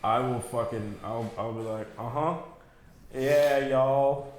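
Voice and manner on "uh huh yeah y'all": imitated Southern twang; Southern accent. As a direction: play along in a folksy Southern accent. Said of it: "a Southern person that's older".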